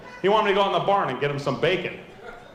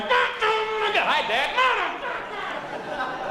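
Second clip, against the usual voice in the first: put-on much higher little boy's greeting. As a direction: chirp it up like a small kid. Son greeting father.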